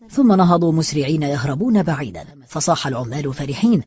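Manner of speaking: ordinary delivery, nothing marked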